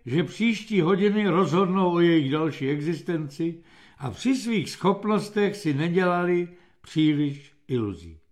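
ordinary delivery

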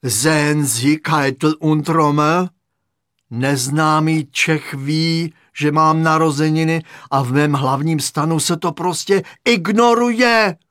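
loud complaint climbing to a high finish; indignant outburst. A narrator's reading. Hitler figure complaining to generals about a forgotten birthday, indignant outburst. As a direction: build to an outraged last word.

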